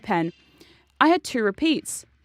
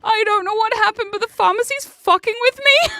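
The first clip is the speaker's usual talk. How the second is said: high, louder, panicky rush; frantic; 10 semitones higher, 5 dB louder than usual. Retold or imitated. Retelling her frantic email to the doctor, panicked and high. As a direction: blurt it out like you're losing it. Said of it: "I frantically get on the email".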